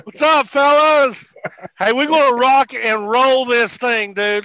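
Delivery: loud, high, hollered hello; rousing greeting. Real, not acted. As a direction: fire up the whole crew. Guest hollers greeting to the group.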